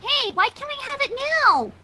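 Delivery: whiny little filly demanding; demanding child. Acted. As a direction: demand it like an impatient kid. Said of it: "Sweetie demanded".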